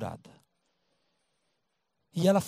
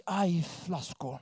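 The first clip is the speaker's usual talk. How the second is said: susto baixinho; 8 dB quieter, much slower than usual. Acted. Mulher se vê descoberta.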